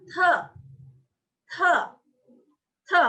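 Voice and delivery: "te" short, T released with a puff; aspirated T. Shown as a demonstration. Teacher showing ada aspirasinya, puffed "te". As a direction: breathe out sharply on each "te". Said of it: "Ada aspirasinya".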